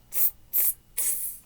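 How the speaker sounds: unvoiced tss sounds; monkey tss hiss. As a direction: hiss back like cheeky monkeys. Monkeys' reply.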